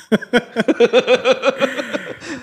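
high burst of laughter; laughing. Real, not acted. Asked if voice in head.

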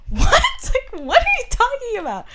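very high and loud, laughing in disbelief; high, laughing, panicky disbelief; freaking out. Retold or imitated. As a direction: shriek the question half-laughing. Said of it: "I was kinda freaking out".

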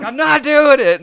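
high, loud flat refusal; shouting refusal. Retold or imitated. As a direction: yell it back, refusing. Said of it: "Kevin Reynolds is like".

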